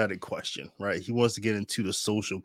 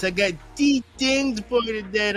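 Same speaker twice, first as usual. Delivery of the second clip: higher, playful Jamaican accent; 10 semitones higher than usual. Shown as a demonstration. Host greeting viewers in a Jamaican accent. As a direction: hype the show up in a Jamaican accent. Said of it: "in the Jamaican voice".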